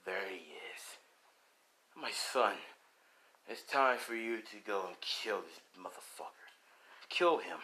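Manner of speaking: low, soft commanding voice; hushed low king sending his son to kill; demon dragon king. Acted.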